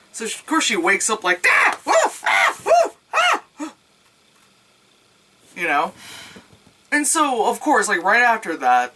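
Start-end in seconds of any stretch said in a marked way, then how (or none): 1.5-3.7 high, loud mock shrieks; hammed-up shrieks on waking; mock waking screams. Waking from the nightmare, hammed-up high shrieks.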